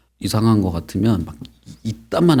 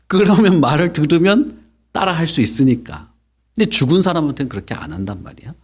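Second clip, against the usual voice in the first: ordinary delivery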